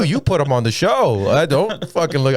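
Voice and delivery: high-pitched defiant comeback; mock indignant. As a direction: act out snapping back at the comics. Retold comeback to other comics.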